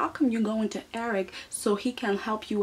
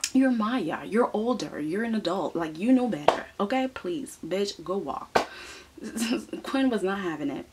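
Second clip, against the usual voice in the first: usual speaking voice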